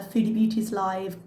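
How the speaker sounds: ordinary talk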